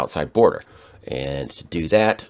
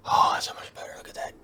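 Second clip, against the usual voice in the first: whispering; 7 dB quieter than usual. Pretend. Joking about action mode.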